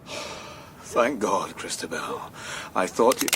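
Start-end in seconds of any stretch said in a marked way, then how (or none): 0.1-2.2 very low, soft exhalation; relieved murmur. Acted. Hyde as she finally opens the door, low relieved murmur. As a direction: sink into deep, quiet relief.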